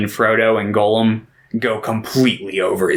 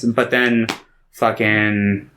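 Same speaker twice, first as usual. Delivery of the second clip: ordinary talk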